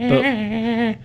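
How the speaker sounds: slow and high; dramatic drawn-out line. Shown as a demonstration. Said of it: "repeats phrase plainly".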